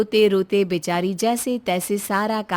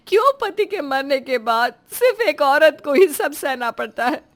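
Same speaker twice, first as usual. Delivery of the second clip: tearful sobbing. Acted. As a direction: pour out despair through tears. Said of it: "बिलख बिलख कर रोती है". (बिलख-बिलख कर रोते हुए)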